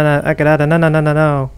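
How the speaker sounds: usual speaking voice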